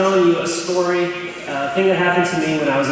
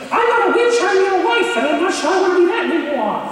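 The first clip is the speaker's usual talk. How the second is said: put-on high, squeaky old witch voice; Princess Bride witch; 4 dB louder, faster than usual. Imitating the teacher as the Princess Bride witch. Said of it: "she talked like the witch from".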